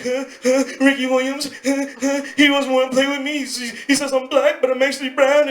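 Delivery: high, hammed-up blubbering; mock crying. Seven-year-old tells mom about rejection, mock crying. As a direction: whine through fake sobbing gasps. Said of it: "she sees me crying".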